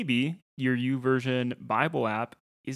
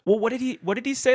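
much higher and louder, suddenly lost; confused catch-up question; 12 semitones higher, 6 dB louder, faster than usual. Retold or imitated. Husband to wife during a show, high baffled louder question. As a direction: jolt back in, baffled about what you missed. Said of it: "and I'm like".

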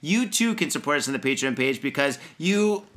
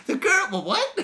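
mock shocked. Real, not acted. Cracks up at own parody.

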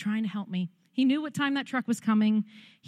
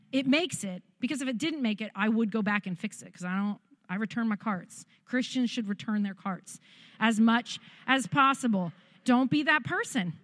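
usual speaking voice

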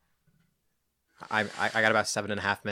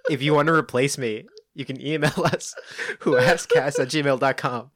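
laughing mock despair; 3 semitones higher, 4 dB louder than usual. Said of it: "Why am I such a failure?".

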